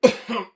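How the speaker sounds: high choking cough from the spice; sharp spicy cough. Real, not acted. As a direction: cough hard as the heat catches.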